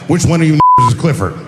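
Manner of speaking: loud, fast mock police demand; mock cop. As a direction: rattle it off like a cop barging in. Imitating police arriving.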